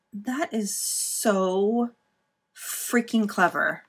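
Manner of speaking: drawn out, very slow in wonder; slowly, in admiration; slow admiring. Real, not acted. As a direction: savour every word slowly. Admiring folded envelope pocket.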